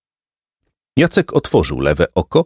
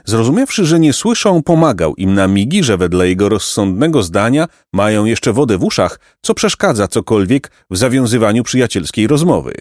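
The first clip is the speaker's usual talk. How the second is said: ordinary delivery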